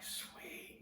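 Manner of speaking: breathy awed whisper; hushed, thrilled whisper; whispered sweet. Retold or imitated. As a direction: whisper it in awe. Hears the huge waves roaring.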